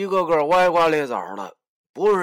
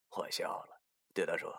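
usual speaking voice

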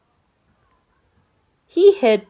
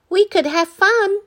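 put-on high boy voice, louder; pleading Danny; 12 semitones higher, 6 dB louder than usual. A narrator's reading. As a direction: beg him to stay. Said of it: "said Danny".

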